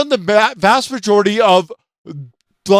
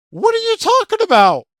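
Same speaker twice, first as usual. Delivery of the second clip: much higher and faster, mock disbelief; incredulous squeal; faster than usual. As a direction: shoot up high and fast in mock outrage. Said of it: "I'm like".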